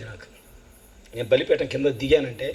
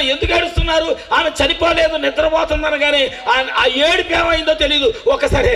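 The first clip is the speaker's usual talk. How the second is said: higher, louder preaching of Jesus' words; preaching shout; 12 semitones higher, 4 dB louder than usual. Acted. Jesus rebuking the wailing mourners, loud and high. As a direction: proclaim it loudly over the mourners.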